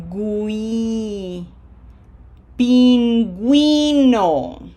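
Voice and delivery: "gui" drawn out as gwee, then "Pingüino" slow and loud; stretched teacher's model of "gui", "Pingüino"; voiced u. Shown as a demonstration. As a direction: sound the w in "Pingüino", stressing GÜI. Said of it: "that middle syllable is going to be".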